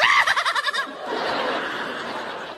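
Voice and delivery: very high, long squealing laugh; little-kid giggle. Acted. Riders splashing like little kids, high childish giggle. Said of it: "splishing and splashing like little kids".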